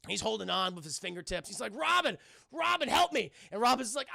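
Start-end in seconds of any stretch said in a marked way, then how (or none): 1.8-3.3 high, loud urgent shout; hammed-up cry for help; mock call for help. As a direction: call out as if dangling from a ledge. Batman calls while hanging from ledge.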